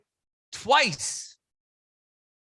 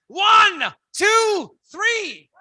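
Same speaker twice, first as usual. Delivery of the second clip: loud countdown; 9 semitones higher, 4 dB louder, much slower than usual. Real, not acted. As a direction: shout the count up high to the crowd. Leading a crowd count.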